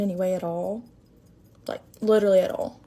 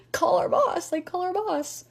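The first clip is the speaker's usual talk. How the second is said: high-pitched, hushed plea to a coworker; acting out an urgent aside for help; 8 semitones higher, slower than usual. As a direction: plead for backup in a raised pitch. Acted plea to coworker while being yelled at.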